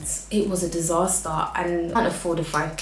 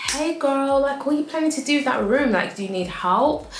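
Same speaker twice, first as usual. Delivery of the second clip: bright high friendly check-in; chirpy friend voice; 7 semitones higher than usual. Retold or imitated. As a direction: sing out a cheery hey girl to a friend. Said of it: "just be like".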